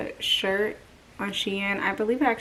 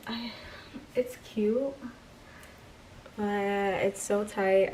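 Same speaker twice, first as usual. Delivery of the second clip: ordinary talk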